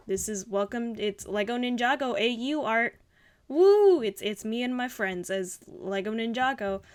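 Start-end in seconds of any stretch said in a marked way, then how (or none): 3.5-4.0 loud, high whoop; excited cheer. Real, not acted. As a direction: cheer it out with glee. Cheering for own Ninjago art.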